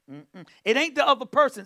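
ordinary talk, nothing marked

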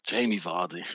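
low whispered sigh of the name Jamie Vardy. Real, not acted. Lamenting missing the game on TV, whispered. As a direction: breathe Jamie Vardy out in despair.

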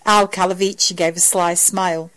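usual speaking voice

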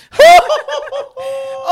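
high, loud burst of laughter. Real, not acted. Player cracking up mid-game, loud high laughter. Frantic end of game round.

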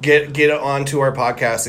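normal talking voice

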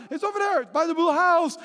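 high-pitched, hammed-up pointing out; imitated excited insistence. Wife directing the searching son, mock excited.

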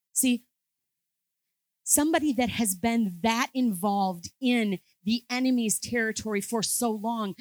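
rally the congregation with conviction; preaching at full force. Acted.

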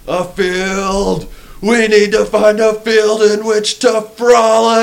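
high, loud, slow gushing; hammed-up excited. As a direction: gush like a giddy hippie. Mock excited quote of actor.

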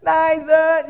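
put-on high, loud call for a lost child. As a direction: call across the shop for your kid. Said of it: "You just start calling".

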